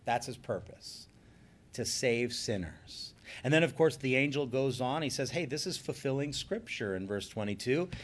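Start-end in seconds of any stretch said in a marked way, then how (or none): none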